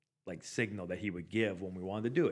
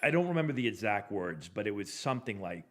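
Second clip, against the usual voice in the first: ordinary delivery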